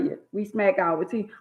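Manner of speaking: ordinary talk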